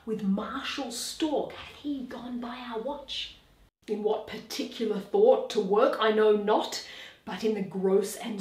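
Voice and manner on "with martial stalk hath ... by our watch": breathy night-watch whisper; hushed whisper. Acted. Guard whispers to comrades about the ghost, hushed.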